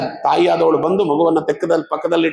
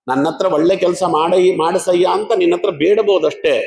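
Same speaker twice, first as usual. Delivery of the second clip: normal talking voice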